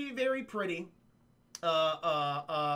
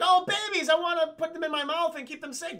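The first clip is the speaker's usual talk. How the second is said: mock crocodile; 9 semitones higher, 4 dB louder than usual. Crocodile voiced cooing babies.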